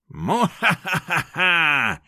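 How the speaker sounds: slow, high mock-evil mwahaha; villain laugh. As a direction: cackle like a gloating villain. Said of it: "смеялся енот в броне мехбосса".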